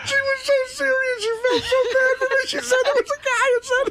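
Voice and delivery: very high squeal of real laughing; barely getting words out through laughter; helpless laughter. Real, not acted. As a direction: voice climbing high as laughter takes over. Helpless laughter retelling the call.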